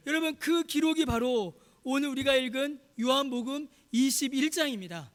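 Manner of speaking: high, emphatic pulpit proclamation; impassioned preaching. Real, not acted. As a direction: proclaim it forcefully to the congregation. Preaches at full force.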